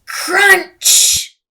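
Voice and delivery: loud crunch. A narrator's reading. Narrator booms the crunch of her hurt finger. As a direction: punch it out like a crash.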